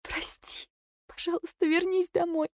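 soft, high weeping plea; crying wife begging him home; tearful pleading. A narrator's reading. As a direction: beg through tears. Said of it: "заплакала я".

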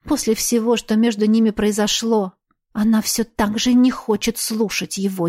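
usual speaking voice

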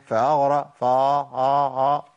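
فأغرق trailing into a drawn-out aa with no ghain; ghain dropped. Shown as a demonstration. Teacher imitating a student who loses the ghain, slow. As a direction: let the ghain vanish into a long vowel. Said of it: "فين الغين يا عم".

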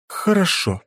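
plain reading voice

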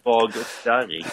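mock-creepy echo. Co-host teasing about a disgusting voice description, slow and low. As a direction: savour the words with mock disgust.

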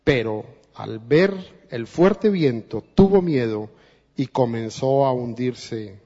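ordinary delivery

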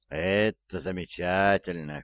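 plain reading voice

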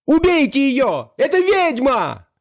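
high, drawn-out accusing cry; shrieked command to the guards; frantic shout. A narrator's reading. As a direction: yell the kill order high and slow. Usurper keeps yelling at bodyguards.

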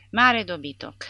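loud, scornful put-down; condescending. A narrator's reading. Micuțul insulting the officer, condescending. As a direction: talk down to him. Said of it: "pe un ton condescendent".

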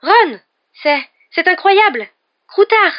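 high, loud shriek of astonishment; shrill, stammering shout of disbelief; piercing cry. A narrator's reading. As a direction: cry out in shock, stumbling over the words. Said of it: "elle poussa un cri perçant".

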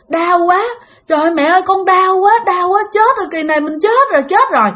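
high, pleading cry of pain; retold wail of someone hurting and dying; pained panic. Victim crying out inwardly to mother, pained panic.

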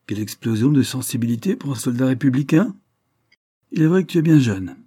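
high, loud mock astonishment; ironic. A narrator's reading. Delmare taunts the tender young republican, ironically. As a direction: tease the young soldier with lofty irony. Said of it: "dit-il d'un ton ironique".

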